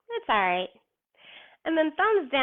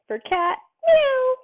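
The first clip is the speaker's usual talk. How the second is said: squeaky high cat meow, much higher and louder; 14 semitones higher, 6 dB louder, slower than usual. Shown as a demonstration. Teacher to kids in cat pose, high playful meow. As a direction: meow like a little kitten.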